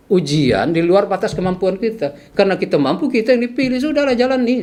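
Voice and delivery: high, pressing exhortation; retold ranting. Patient urging sick man to endure, ranting insistently. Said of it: "Sampai saya ngoceh-ngoceh gitu".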